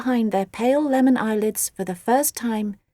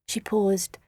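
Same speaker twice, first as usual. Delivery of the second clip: ordinary delivery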